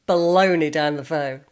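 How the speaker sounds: ordinary delivery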